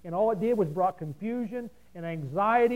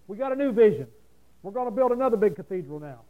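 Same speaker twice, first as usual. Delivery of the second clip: lightly mocking, raised-voice quote; mock announcement; 7 dB louder than usual. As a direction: proclaim it like a big ministry newsletter. Quoting another ministry's proud announcement.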